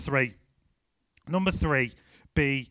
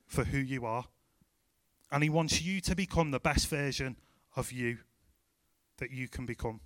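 tender reassurance; 2 semitones lower, 4 dB quieter than usual. Real, not acted. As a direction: reassure them gently, letting each phrase settle. Tender appeal closing the sermon.

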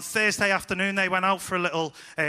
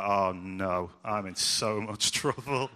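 mock dread; 8 semitones lower, 3 dB quieter, slower than usual. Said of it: "I'd be like".

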